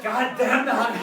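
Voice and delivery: loud mock outburst; exasperated cursing. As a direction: curse it loudly as the fed-up friend. Exasperated friend curses the honey.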